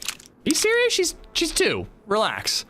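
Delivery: squeaky high disbelief, then coming down; mock-aghast "are you serious"; high-pitched disbelief settling into a flat "relax"; incredulous retort. Incredulous retort to snarky mom.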